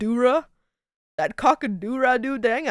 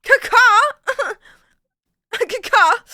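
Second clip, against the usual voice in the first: high, loud cawing through laughter; giggling high bird call; raven caw; 10 semitones higher, 4 dB louder, slower than usual. Pretend.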